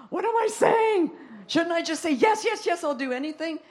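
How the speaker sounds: high, loud laughing self-scolding; laughing at herself. Real, not acted. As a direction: laugh through the words at your own folly. Laughing at her own hesitation.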